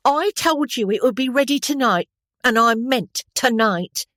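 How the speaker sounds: high, loud scolding washerwoman. A narrator's reading. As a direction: tell off the officer, stick waving. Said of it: "could not imitate her broad Cockney accent".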